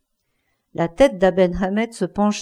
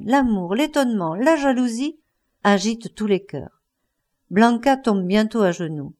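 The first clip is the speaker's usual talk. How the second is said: ordinary delivery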